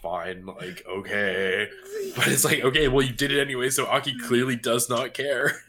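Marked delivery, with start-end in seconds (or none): 0.0-1.7 lower, softer, sulky mumble; dejected. Retold or imitated. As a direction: give in like a sulking kid. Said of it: "they just look so dejected".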